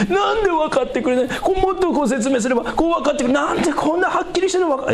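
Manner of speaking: exasperated evangelist. Retold or imitated. Frustrated evangelist acted out.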